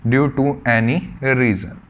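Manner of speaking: due to any reason drawn out slowly, stress on due; stressing the key phrase; slow emphatic. Shown as a demonstration. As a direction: lean on each word slowly. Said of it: "किसी से भी".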